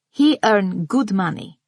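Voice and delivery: slow, pressing "He earned good money" firmly; emphatic. A narrator's reading. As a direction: lean on each word of "He earned good money". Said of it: "she says emphatically".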